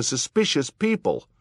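loud, falling SUSPICIOUS PEOPLE; calling out. A narrator's reading. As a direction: announce SUSPICIOUS PEOPLE as a firm call. Guest calls out, another repeats it.

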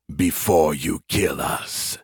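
deep, slow alien growl. Acted. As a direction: drag it out in a menacing growl. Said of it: "an alien voice growled".

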